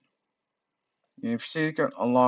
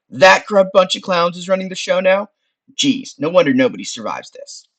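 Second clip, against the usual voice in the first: scornful skull, loud and higher; Frank; 7 semitones higher, 7 dB louder than usual. Acted. Skull scorning the council, loud wise-guy voice. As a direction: exclaim it like a fed-up wise guy.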